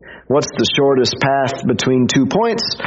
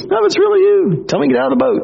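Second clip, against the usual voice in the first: excited disbelief; 14 semitones higher, much faster than usual. Retold or imitated. Peter calling to Jesus on the water, high excited burst. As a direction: gush at him in thrilled disbelief.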